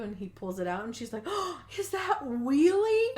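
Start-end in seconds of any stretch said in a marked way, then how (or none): 1.3-3.2 high, loud, drawn-out squeal of a question; hammed-up childlike excitement; mock gushing delight. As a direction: gasp and gush over a beloved toy. Acts out delight at beloved pinwheel.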